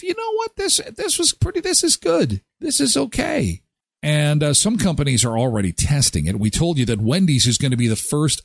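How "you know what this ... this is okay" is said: satisfied customer. Retold or imitated. Customer pleased with the drive-through, high mock voice. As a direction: squeak out your satisfaction. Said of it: "There's that feeling of".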